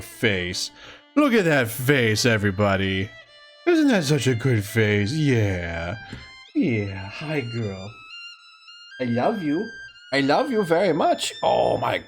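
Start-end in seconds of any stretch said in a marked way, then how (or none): none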